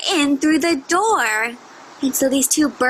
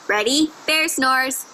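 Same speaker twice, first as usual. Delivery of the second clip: ordinary talk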